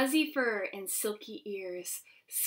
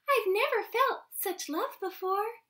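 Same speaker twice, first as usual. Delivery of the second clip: squeaky mama; 10 semitones higher than usual. Acted. Mama voiced in story.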